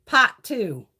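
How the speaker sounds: put-on a light Boston accent. Vlogger sounding out a misspelt palette name, light Boston accent. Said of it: "trying to do the Boston fucking accent".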